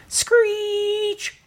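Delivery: high squealing tire-screech noise; mock car skid. A narrator's reading. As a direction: screech like brakes locking up. Narrator imitates tire screech.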